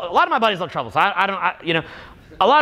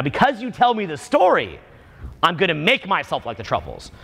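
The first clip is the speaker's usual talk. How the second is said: ordinary delivery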